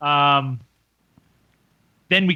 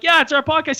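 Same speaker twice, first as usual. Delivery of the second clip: keen confirmation; 11 semitones higher, faster than usual. Retold or imitated. Retold keen answer to fan.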